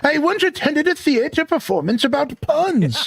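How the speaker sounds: very high wizard character voice; Merlin. Acted. Wizard tells a joke about a theater visit, high character voice. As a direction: set up the joke in a high put-on voice. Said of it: "I do love me a good Merlin voice".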